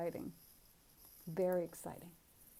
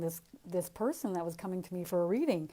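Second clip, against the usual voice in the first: usual speaking voice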